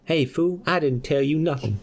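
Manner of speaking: high put-on voice, tough and cocky; kangaroo Jackson voice. Jackson the kangaroo snapping back at the guy in a high put-on voice. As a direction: squeak it out like a cheeky kangaroo talking tough. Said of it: "Why does Jackson keep changing voice".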